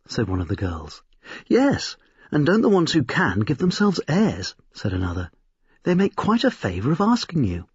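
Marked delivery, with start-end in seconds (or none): 1.5-4.5 high, mocking girl's voice; lightly voiced scornful girl; scoffing young girl. A narrator's reading. Second girl scoffs at the men. 5.8-7.7 high, mocking girl's voice; lightly voiced scornful girl; scoffing young girl. A narrator's reading. Girl mocks the men.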